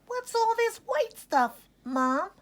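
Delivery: put-on high squeaky young voice; squeaky little lamb. A narrator's reading. Ollie squeaking to his mom about the snow, high and squeaky. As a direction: squeak it out like a tiny curious lamb. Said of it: "he squeaked".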